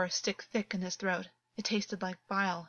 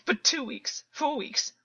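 distraught; 3 dB louder than usual. A narrator's reading. Distraught, half-hysterical confession.

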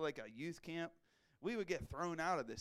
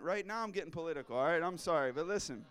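normal talking voice

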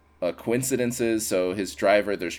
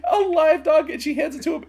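laughing; 3 dB louder than usual. Real, not acted. Laughing while retelling.

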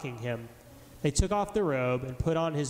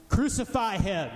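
higher, slower and louder mob cry; louder roar; 6 semitones higher than usual. A narrator's reading. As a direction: let the crowd's demand swell over the first. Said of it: "the mob roared even louder".

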